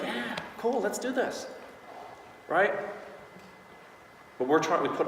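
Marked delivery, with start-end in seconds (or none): 0.0-1.5 casual, breezy yes; a relaxed shrug of a reply; nonchalant. Shown as a demonstration. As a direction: toss it off like it's no big deal. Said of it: "Nonchalant. Very like".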